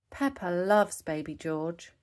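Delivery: ordinary delivery, nothing marked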